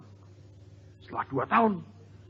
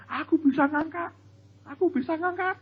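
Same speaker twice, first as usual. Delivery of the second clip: put-on high, proud little kid's voice; boasting child; 11 semitones higher than usual. Child boasting to others, high proud voice. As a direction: brag like a small child. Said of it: "Dia cerita ke orang lain".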